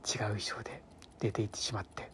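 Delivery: low confiding whisper; hushed whisper. Real, not acted. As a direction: whisper the embarrassing part. Describing the costume blunder.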